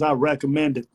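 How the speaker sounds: usual speaking voice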